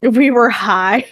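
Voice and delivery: slow, tipsy and giggling. Real, not acted. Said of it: "a little tipsy right now".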